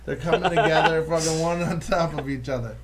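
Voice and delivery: ordinary talk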